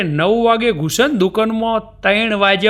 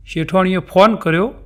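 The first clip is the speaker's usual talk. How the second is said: ordinary talk, nothing marked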